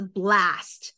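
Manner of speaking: American accent. Shown as a demonstration. Explaining American vowels, slow American accent on blast. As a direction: blast with a flat American "a", drawn out slowly. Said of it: "美国的说话是".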